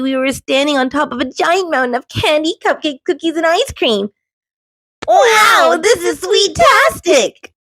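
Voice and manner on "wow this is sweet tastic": louder, much higher, delighted squeal; happy shriek. A narrator's reading. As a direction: shriek with joy over the candy. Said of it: "shrieked with happiness".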